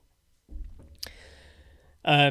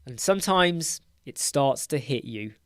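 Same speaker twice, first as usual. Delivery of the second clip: ordinary delivery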